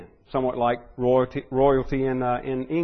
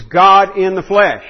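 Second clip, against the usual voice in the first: slow, louder, every word weighted; emphatic proclamation; 4 semitones higher, 9 dB louder, slower than usual. Real, not acted. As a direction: proclaim it slowly and firmly. Preacher stresses Christ's divinity.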